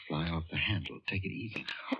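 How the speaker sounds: hushed, breathy calming whisper. Acted. Friend quietly calms the upset wife in a whisper. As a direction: soothe her under your breath.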